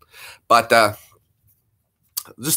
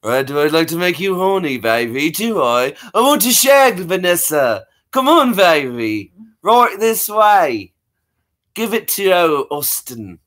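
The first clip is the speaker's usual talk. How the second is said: Austin Powers; 4 semitones higher than usual. Acted. Spy flirting with Vanessa, swaggering British accent. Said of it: "Austin Powers".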